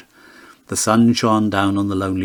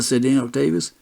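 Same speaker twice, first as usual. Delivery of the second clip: usual speaking voice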